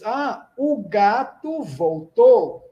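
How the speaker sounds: slow, leaning on "o" in "o gato voltou"; the definite "o" made plain and slow; stress on the article. Shown as a demonstration. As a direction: drag it out and hit the "o". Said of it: "olha agora você tá usando o".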